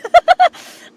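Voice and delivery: high, loud laugh that cracks into crying; a real laugh-sob that spills out; laugh breaking into a sob. Real, not acted. Retelling her grandmother's last days, a laugh that turns to a sob.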